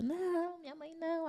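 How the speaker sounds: high, strained cry of no, not quiet like a real scream; a mild mock wail of despair, pitched high; retold anguished cry. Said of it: "Aí eu gritava".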